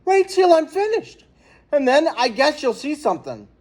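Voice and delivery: high, flustered comeback; flustered dad answering his bragging neighbor; embarrassed stammer. A narrator's reading. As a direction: scramble to save face, pitch jumping up. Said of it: "was suddenly embarrassed".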